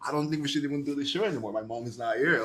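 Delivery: retold panic, rushed words; freaking out. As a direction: re-enact a mild backstage panic, gabbling. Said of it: "just freaking out".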